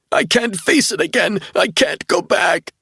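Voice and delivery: put-on high, panicked cry of a scared little boy; frightened sobbing plea. A narrator's reading. Young man on the bed sobs to Caroline, high terrified plea. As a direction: break into a terrified sob. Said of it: "began to sob like a frightened little boy".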